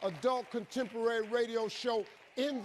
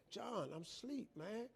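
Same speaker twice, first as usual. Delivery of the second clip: very soft, groggy complaint; worn-down sleepy reply; sleepy protest; 12 dB quieter than usual. Acted. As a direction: plead faintly from half asleep.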